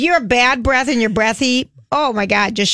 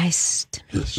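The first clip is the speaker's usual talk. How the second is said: airy whisper, much softer and lower; breathy whisper; 7 semitones lower, 8 dB quieter than usual. Shown as a demonstration. Cohost demos a breathy greeting, whispered. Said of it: "talk in a breathy, sexy way".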